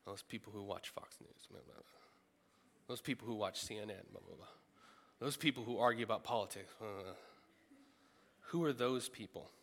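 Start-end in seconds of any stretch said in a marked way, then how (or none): 1.5-1.8 tossed-off blah, nearly under the breath; muttered mock chatter, much softer; dismissive blah. Mocking those people's talk. 4.1-4.5 tossed-off blah, nearly under the breath; muttered mock chatter, much softer; dismissive blah. Mocking those people's talk. 6.8-7.1 tossed-off blah, nearly under the breath; muttered mock chatter, much softer; dismissive blah. Mocking those people's talk.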